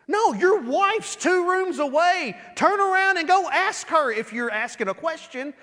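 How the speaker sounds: preacher rebuking the listener; emphatic. Real, not acted. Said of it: "emphatic preaching rebuttal".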